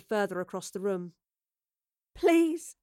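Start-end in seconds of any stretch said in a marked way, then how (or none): 2.1-2.8 high, weak pleading; feeble, high-pitched begging; weak plea. A narrator's reading. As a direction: plead weakly. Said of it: "Evie said weakly".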